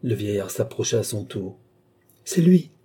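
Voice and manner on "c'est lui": loud, high cry of recognition. A narrator's reading. Old man finding the boy, crying out. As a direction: burst out with it, pitched high. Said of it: "s'écria-t-il en ouvrant les bras".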